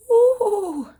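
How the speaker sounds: high loud pained squeal. Real, not acted. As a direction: yelp as the eye stings. Silver glitter stings her eye.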